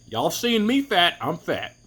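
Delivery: normal talking voice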